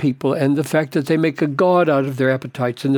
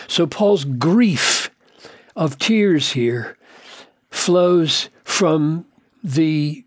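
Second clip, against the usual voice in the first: slow; much slower than usual. Real, not acted. Preacher lingers on Paul's grief.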